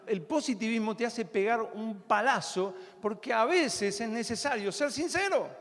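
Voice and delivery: usual speaking voice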